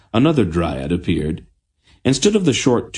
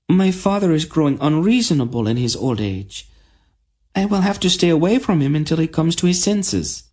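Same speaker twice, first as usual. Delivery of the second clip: put-on high, quick girlish voice; young princess; 11 semitones higher than usual. A narrator's reading. Princess Tenedra to the queen, high and girlish. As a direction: ask a favour sweetly.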